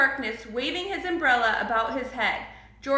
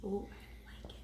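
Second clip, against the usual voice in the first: hushed little whisper; 5 semitones lower, 17 dB quieter than usual. Real, not acted. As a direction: whisper your delight.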